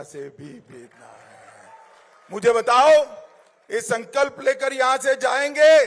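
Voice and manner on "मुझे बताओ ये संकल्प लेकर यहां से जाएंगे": rousing call to the crowd; booming political rally speech. Acted. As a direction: demand an answer from the crowd. Asks crowd for a pledge.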